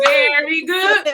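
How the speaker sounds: loud, slow cheering chant; excited cheer. Acted. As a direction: cheer it out with gusto. Laughter yoga cheer chant.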